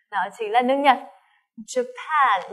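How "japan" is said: Japan with the weight on PAN; stress second syllable. Shown as a demonstration. Teacher drills Japan, stress on the second syllable. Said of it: "trọng âm thứ hai này".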